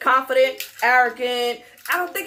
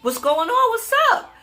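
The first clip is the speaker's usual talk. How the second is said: raised, high-pitched mock call across a lot; retold calling out; 11 semitones higher, 4 dB louder than usual. Retelling her reply to honking driver, calling out. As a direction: call out questioningly, pitch jumping at the end.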